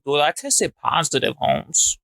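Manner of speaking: put-on small, petite Mexican accent; a light mock Mexican accent; tiny Mexican voice. Mocking Tiny Tiger in a petite Mexican voice. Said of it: "most petite Mexican voice".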